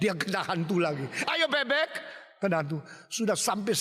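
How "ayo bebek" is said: high-pitched needling call; taunting threat. Acted. Brother taunting the younger with duck.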